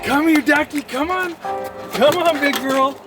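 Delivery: high coaxing call; talking to a dog. Real, not acted. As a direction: coax the dog over. Calling the dog.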